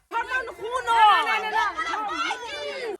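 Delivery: high, different put-on voice; mock her backing down; mocking impression. Girl refusing to fight, high mocking impression. Mimicking the other girl's reply.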